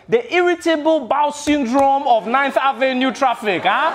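put-on relishing Nigerian accent. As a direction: deliver the simile with Nigerian flair. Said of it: "Nigerians have the best metaphors".

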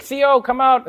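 high, loud summons across a workshop; calling out. Retold or imitated. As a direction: call someone out from the back. Dealership staff calling the mechanic.

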